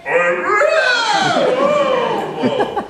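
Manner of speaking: brain breaking. Retold or imitated. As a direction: voice cracks very high and loud. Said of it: "your brain starts to break".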